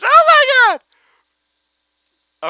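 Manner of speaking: very high, loud mock scream; fan scream. Shown as a demonstration. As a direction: scream it as the summary. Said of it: "I'm spiking all over the place".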